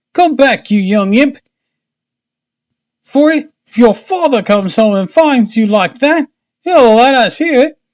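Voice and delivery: high, loud mother's scolding call; shouted. A narrator's reading. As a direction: holler high and loud after a runaway child. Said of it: "she shouted".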